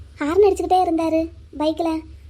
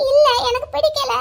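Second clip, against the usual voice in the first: tearful; 6 semitones higher than usual. Acted. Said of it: "எதுக்கு இங்க உட்காந்து அழுதுகிட்டு இருக்க".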